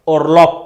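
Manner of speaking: "Orlop" ending in a hard P; final B as P. Shown as a demonstration. Teacher repeating the example word, final B as P. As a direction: say "Orlop" loudly, closing on P. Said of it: "spelt as P".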